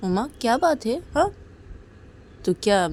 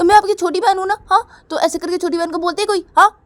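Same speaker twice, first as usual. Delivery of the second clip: high, loud protest; hurt little sister; 8 semitones higher, 5 dB louder than usual. Acted. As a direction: complain like a wronged child. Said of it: "Uma बोलती कि".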